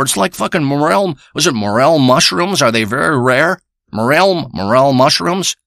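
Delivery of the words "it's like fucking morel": ordinary delivery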